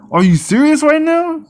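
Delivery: high, stunned disbelief; exasperated disbelief; incredulous. Real, not acted. Repeats earlier line higher.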